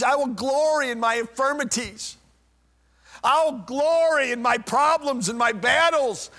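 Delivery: loud, high, ringing proclamation; Paul's triumphant declaration. Acted. Paul preaching to the church, loud and triumphant. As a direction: proclaim it like a victory cry. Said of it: "And Paul said".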